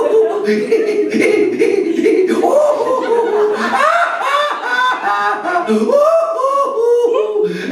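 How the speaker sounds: high hooting laugh; mock hooting laughter; mocking boyish laugh. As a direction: hoot with glee like teasing boys. Boys laughing at Krishna.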